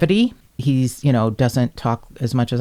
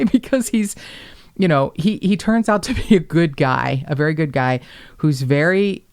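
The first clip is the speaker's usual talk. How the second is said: usual speaking voice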